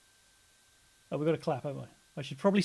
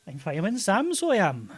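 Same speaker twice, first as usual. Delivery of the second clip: put-on playful, lilting South Wales accent; Welsh accent; 3 semitones higher, 4 dB louder than usual. Co-host playing Fireman Sam, put-on Welsh accent. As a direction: announce yourself in comic Welsh. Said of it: "I struggle doing the Welsh accent".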